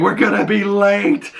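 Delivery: high, hammed-up frantic retort; mock panic. Kid dragging a body away, mock panic.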